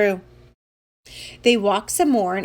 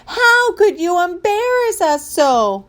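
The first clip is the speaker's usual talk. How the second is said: high, slow wail of reproach; mortified moms' drawn-out shout; outraged yell; 8 semitones higher, 4 dB louder than usual. Acted. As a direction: cry out in wounded outrage. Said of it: "their moms yelled".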